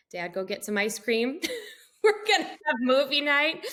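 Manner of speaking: cheerfully rally the family, giggling; laughing announcement. Retold or imitated. Telling husband to get ice cream, bright laughing call.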